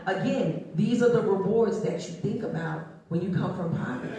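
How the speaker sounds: ordinary talk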